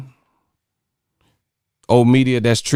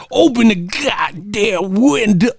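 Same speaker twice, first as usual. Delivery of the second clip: mock Big Red line, ending in a much higher drawn-out shout; quoting Big Red; 9 semitones higher, slower than usual. Quoting a movie to chat, in a lightly voiced Big Red. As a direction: ham up Big Red, stretching the last line. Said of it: "He doing the five heartbeats".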